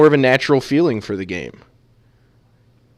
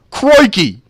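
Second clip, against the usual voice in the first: hammed-up Australian accent, high and loud; mock Australian exclamation; 9 semitones higher, 8 dB louder than usual. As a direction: shout it like a cartoon Aussie. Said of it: "I can't do it Australian".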